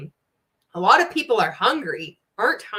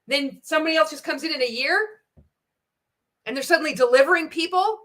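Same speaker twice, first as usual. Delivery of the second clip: higher mock pitch voice; mocking imitation; 7 semitones higher, 3 dB louder than usual. Quoting the MLM guy to viewers, pitched-up mock. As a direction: parrot his hype, pitched up.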